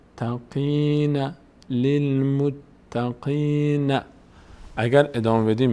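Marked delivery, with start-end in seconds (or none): 0.0-4.0 للمتقین stretched very slowly, syllable by syllable; للمتقین sounded out very slowly; drawn out slowly. Shown as a demonstration. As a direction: spell out للمتقین slowly for the student.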